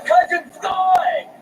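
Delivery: slow, loud call. Acted. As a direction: shout his name across the room. Calling out to his cousin.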